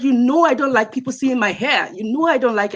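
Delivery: ordinary talk, nothing marked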